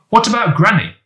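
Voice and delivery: high, anxious, rushed questions from Ben; a worried boy blurting it out fast and high. A narrator's reading. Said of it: "said Ben anxiously".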